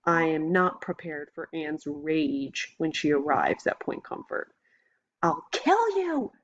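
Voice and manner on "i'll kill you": high, slow enraged shriek. A narrator's reading. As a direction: scream it out in murderous rage. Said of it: "Anne shrieks".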